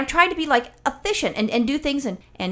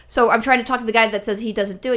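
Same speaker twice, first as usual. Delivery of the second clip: ordinary talk, nothing marked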